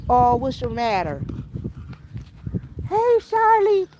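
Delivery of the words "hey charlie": much higher, louder, slow sing-song call; calling the horse. Real, not acted. Owner calls her horse Charlie to the gate, high sing-song call. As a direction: call out to the horse across the field.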